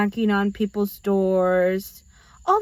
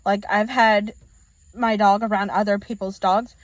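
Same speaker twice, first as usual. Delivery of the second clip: ordinary talk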